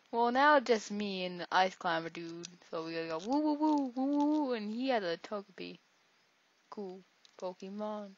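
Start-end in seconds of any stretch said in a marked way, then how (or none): 2.7-4.5 rising, louder playful woos. Pretend. Gamer chanting woo as he attacks, playful chant. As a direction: chant woo like a silly battle cry. Mimicking the sound of his attack.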